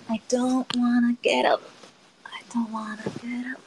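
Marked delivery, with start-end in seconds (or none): 2.2-3.6 whispered, breathy and unvoiced; whispering. Real, not acted. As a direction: whisper it under your breath. Whining about getting out of bed.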